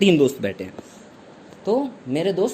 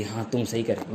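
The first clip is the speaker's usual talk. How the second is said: meek quiet admission; 9 semitones lower, 9 dB quieter than usual. Retold or imitated. Teacher meekly concedes.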